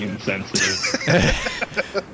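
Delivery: slow words through a laugh. Real, not acted. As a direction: say it while laughing. Joke about blind guards.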